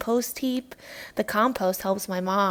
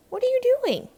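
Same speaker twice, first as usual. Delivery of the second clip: put-on surprised child; 5 dB louder, faster than usual. Child asks teacher at gate.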